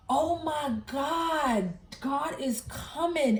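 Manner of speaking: high, slow tearful wail, lightly acted; retold crying. Hugging her sister in the dream, slow tearful wail. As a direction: let the awe crack through slowly. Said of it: "but I was crying like".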